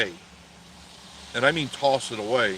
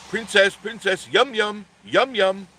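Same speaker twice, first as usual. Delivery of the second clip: higher, louder sing-song Princess, yum yum; sing-song calling; 9 semitones higher, 4 dB louder than usual. Retold or imitated. Man calling Princess to the kitchen, sing-song and higher. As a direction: coax her in with a teasing lilt.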